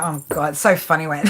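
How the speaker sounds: ordinary talk, nothing marked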